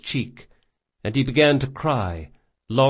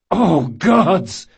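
loud, high cry of alarm; cry of shock; 7 semitones higher, 7 dB louder than usual. Acted. Jean finds Locke bleeding and cries out loudly. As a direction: shout it out in horror. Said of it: "Jean cried".

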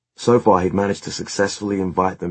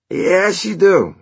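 high, loud bickering retort; very high, insistent contradiction; taunting; 14 semitones higher, 8 dB louder, much slower than usual. A narrator's reading. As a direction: jeer back at a bandmate. Bandmates bickering in the story.

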